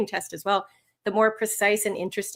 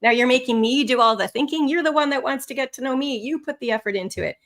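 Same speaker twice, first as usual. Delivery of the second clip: usual speaking voice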